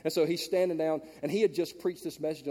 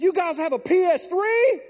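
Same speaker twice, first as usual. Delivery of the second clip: put-on high, slow, wide-eyed kid asking; a boy marvelling at the game room, high and drawn out; excited kid voice; 10 dB louder than usual. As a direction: play the awed fifth grader. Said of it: "He said".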